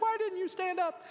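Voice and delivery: hammed-up hurt mother pressing her son; upset mom. Mom on the couch confronting her son, high pitched demand. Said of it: "She's like".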